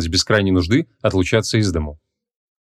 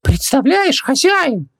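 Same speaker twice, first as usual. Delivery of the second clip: excited, very high squeaky djinn; high creature voice full of wonder; Хубаксис, amazed; much slower than usual. Acted. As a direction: gush to the master in a tiny voice. Djinn returns amazed by bathroom.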